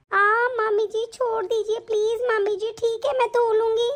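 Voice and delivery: put-on high little girl pleading, please; a small girl begging to be let go; childlike begging. Niece begging her aunt to let go, high and childlike.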